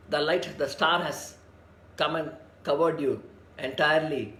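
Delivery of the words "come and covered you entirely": ordinary talk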